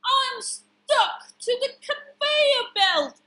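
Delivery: high, loud, slow wail; hammed-up panic for children; distressed cry. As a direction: wail it as a stuck carrot. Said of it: "cried Carrot".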